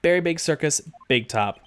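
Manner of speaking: usual speaking voice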